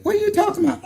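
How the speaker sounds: lightly voiced confused girlfriend; girlfriend's reply. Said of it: "she's like".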